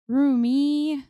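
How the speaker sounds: high, drawn-out whine of a name; whiny call. A narrator's reading. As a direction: whine her friend's name, pleading. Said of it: "Zoe whines".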